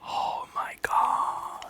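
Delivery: slow whispered exasperation; exasperated whisper. Real, not acted. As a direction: breathe it out in despair. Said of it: "exasperated aside".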